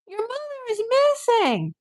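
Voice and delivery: high, slow disbelieving cry; incredulous. Real, not acted. Vlogger reacting to the greeting card, high and incredulous. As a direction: stretch it out in disbelief.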